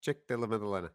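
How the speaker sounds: low, soft, reluctant reveal; suddenly quiet. Acted. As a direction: drop the name under your breath. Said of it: "you went a bit quiet there".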